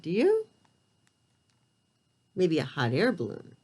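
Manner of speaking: ordinary delivery, nothing marked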